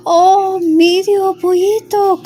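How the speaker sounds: high, loud call from the wind. A narrator's reading. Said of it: "called the wind".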